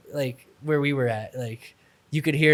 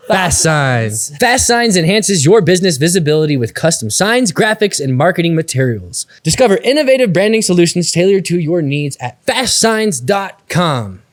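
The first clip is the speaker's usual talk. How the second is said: louder, punchy commercial read; 3 semitones higher, 9 dB louder than usual. Acted. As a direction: pitch it to listeners like radio. Reads sponsor ads.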